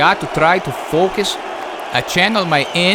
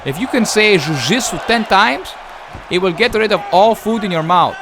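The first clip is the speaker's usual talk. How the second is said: ordinary talk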